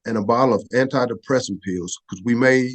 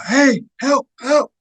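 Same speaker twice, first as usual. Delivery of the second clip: high, loud cry for help through the wall; calling for help; 13 semitones higher, 3 dB louder, slower than usual. Retold or imitated. Soldier pounding the barracks wall, shouting for help. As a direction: yell for help, pitched way up.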